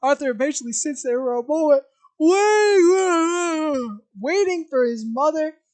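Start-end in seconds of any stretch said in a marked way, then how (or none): 2.2-5.6 high, loud, slow stretched waiting; drawn-out impatient. Acted. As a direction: drag it out impatiently.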